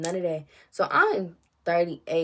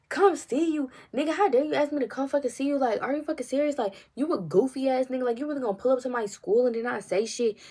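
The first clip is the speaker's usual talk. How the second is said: higher, indignant retort, words tumbling out fast; indignant rant; 7 semitones higher, 3 dB louder than usual. Retold or imitated. Outraged at a guy who drove by without stopping. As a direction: tell him off, outraged he even asked. Angry retort at him on phone.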